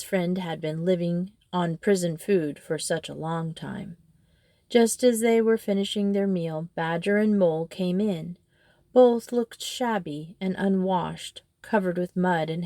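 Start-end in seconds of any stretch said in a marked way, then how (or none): none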